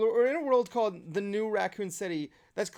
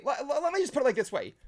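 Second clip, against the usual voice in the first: ordinary talk, nothing marked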